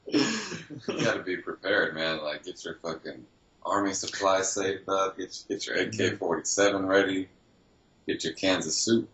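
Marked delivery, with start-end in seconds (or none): none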